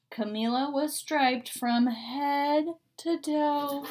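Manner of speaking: plain reading voice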